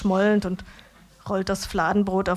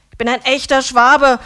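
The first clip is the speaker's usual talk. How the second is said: loud, higher, chest-thumping boast; proud declaration; 5 semitones higher, 11 dB louder than usual. A narrator's reading. Döner man proudly declaring to the narrator, loud. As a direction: proclaim it like a battle cry.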